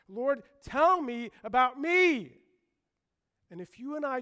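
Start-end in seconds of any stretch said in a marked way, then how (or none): 0.1-2.4 slow, high, loud cry to God; drawn-out, ringing appeal; impassioned plea. Acted. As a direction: call out the prayer with full force. Preacher cries out a prayer.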